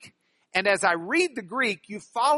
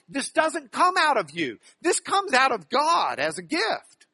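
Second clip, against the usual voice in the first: higher voice, contrasting "you" with "God"; emphatic contrast; 6 semitones higher, 3 dB louder than usual. Shown as a demonstration. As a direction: set "out of God" firmly against "out of you". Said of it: "he makes that emphasis".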